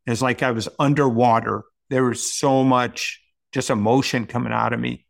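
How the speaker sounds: ordinary talk, nothing marked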